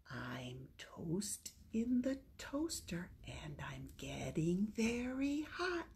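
soft, secretive whisper. Pretend. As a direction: hush it like a playful secret. Whispered toaster game rhyme.

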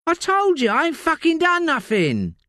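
high, coarse London voice of a desperate addict; Layla, rough addict. Acted. Addict Layla snapping at detective Josie in interview. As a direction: snap like a strung-out woman in custody.

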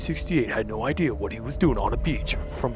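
hushed horror-story narration; spooky narrator whisper. Pretend. Mock dark narration.

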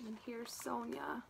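hushed whisper. Real, not acted. Showing the children the picture, whispering. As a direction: let them in on a secret.

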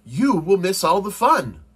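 high, loud pleading protest; crying out. A narrator's reading. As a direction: plead loudly with a sleepy friend. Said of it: "cried Frog".

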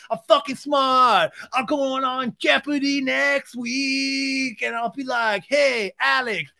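high, slow dopey bro voice, building to a shout; hammed-up dumb guy boasting; mock Sandoval voice. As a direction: play him as a proud dim bro. Mock Sandoval rant, held high voice.

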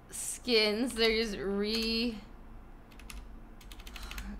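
ordinary talk

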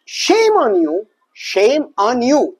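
very slow, every word drawn out; slow drill. Shown as a demonstration. Teacher drilling a phrase for students, very slow. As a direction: spell out each word slowly for the learner. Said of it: "تحفظها كده مع بعض".

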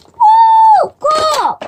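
high, loud squeal of delight; excited cheer. Real, not acted. Kid opening a calendar door, squealing with delight. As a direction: shriek with joy at the surprise.

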